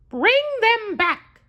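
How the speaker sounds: high cartoon mouse voice, slow and deliberate; Mickey ordering slowly. Mickey slowly telling the broom to climb, high mouse voice. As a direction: spell out each word up high like a cartoon mouse. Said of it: "said Mickey".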